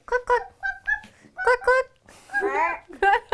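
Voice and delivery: high playful clucking; chicken clucks. Shown as a demonstration. Mom shows the kids the chicken's call, high clucks. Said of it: "And what does the chicken say?".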